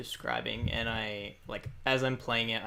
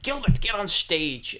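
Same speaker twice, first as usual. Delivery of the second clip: loud, high, slow summons; showman's raised-voice call; calling out onstage; 8 semitones higher, 7 dB louder, slower than usual. Acted. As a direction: call a companion up in front of the crowd. Bard calls companion up onstage.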